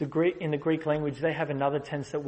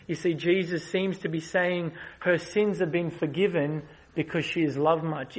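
ordinary talk

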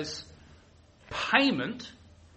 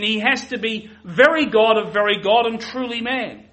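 forceful preaching; 3 semitones higher, 6 dB louder than usual. Acted. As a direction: drive each doctrine home from the pulpit. Preaching the two natures forcefully.

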